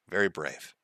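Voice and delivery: quiet and low, deadpan sarcasm; dry sarcasm. Real, not acted. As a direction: say it dry and understated. Sarcastic comment.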